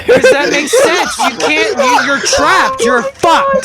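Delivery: high, loud exasperated rant; incredulous rising tirade; worked-up rant. Real, not acted. Heated rant about the booth.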